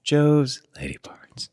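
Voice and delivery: slow breathy whisper; sultry whisper. Acted. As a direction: whisper it seductively, slowly. Sultry whispered joke reading.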